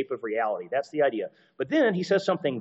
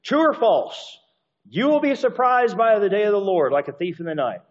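quiz question; 6 semitones higher, 4 dB louder than usual. Pretend. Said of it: "if I just asked you".

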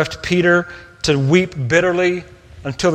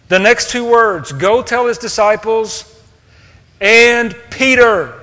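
rising louder and higher to land on Peter; building to Peter; 6 semitones higher, 4 dB louder than usual. Real, not acted. Preacher revealing the angel named Peter, building to a loud peak. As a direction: build and hit "Peter" as the climax. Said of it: "Does anyone know the next two words".

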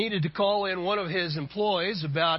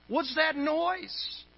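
high, drawn-out worried question; mildly alarmed boss, pitched way up; 8 semitones higher, slower than usual. Acted. Boss hearing a helicopter over the phone, worried. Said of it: "Growing concerned and even worried".

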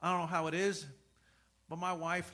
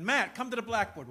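louder, sharp summons; teacher calling out; 4 semitones higher, 8 dB louder than usual. Retold or imitated. Teacher calling daydreaming student to the board, loud. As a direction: call the kid up front.

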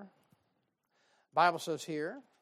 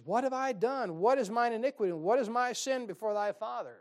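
a hint of frantic David, higher and louder; frustrated David; 4 semitones higher, 6 dB louder than usual. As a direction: plead like a worked-up man. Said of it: "he's up in arms. He's all frustrated".

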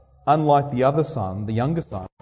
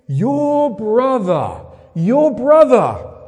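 your brother drawn out slow and rising louder; insistent repetition; 7 semitones higher, 7 dB louder, much slower than usual. Acted. Father insisting to the older son, slow and emphatic. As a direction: press the word brother home.

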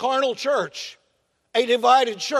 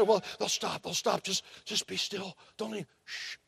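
very soft whispered hushing; calm yourself in a whisper so the baby sleeps; hushed whisper; 11 dB quieter than usual. Retold or imitated. Parent hushing at night.

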